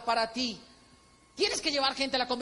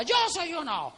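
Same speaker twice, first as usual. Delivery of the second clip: higher mock crowd yell; crowd shout; 6 semitones higher than usual. Convention crowd answering the slogan, raised mock shout. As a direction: shout it like a fan jumping up. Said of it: "la gente se paraba y gritaba".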